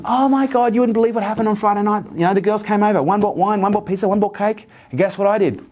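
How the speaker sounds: proud client bursting to tell her trainer, very high; bubbly excitement. Retold or imitated. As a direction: bounce in proud and thrilled. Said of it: "she'd come in bouncing".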